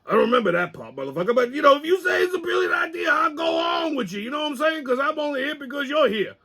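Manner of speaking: high-pitched, protesting sidekick voice; Pops. Acted. Sidekick going along with the host, high put-on voice. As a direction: climb into a high, strained character voice. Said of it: "I consulted my trusty sidekick, Pops".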